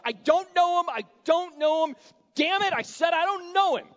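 loud, high shouted oath; furious denial. Acted. Preacher acting Peter swearing at the courtyard crowd, furious yell. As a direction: yell it like a cornered man swearing. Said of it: "he's just coming uncorked".

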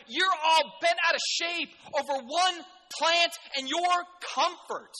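high, loud, slowed scolding; God's rebuke. Acted. Preacher voicing God rebuking Jonah, loud and high. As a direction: hammer the rebuke home, raising the voice. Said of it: "He's saying".

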